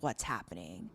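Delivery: voiced low and hushed as the frightened heroine; scared hush. Recapping the heroine alone in the break room, hushed. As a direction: hold the line under your breath.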